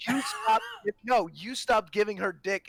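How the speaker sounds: loud, high accusing outburst; angry. Acted. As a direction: shout the accusation at him. Heated family argument, accusing.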